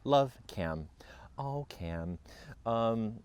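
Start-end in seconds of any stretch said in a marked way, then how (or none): none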